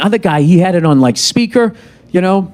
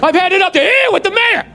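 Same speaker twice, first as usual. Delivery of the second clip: higher, shouting chief chewing out a cop; angry police captain; 12 semitones higher than usual. Pretend. Movie police chief yelling at a rogue cop, furious. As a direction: bark him off the case like a fed-up captain.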